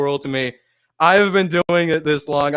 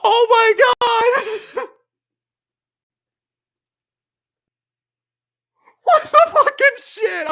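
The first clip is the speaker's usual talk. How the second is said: high-pitched squealing disbelief, breaking into laughter; real overwhelmed shock. Real, not acted. Streamer just got raided, squealing in happy shock. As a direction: squeal as if you can't believe your luck.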